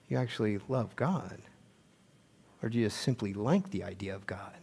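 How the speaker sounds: soft whispered challenge; hushed searching question. Real, not acted. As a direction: whisper the question to each listener.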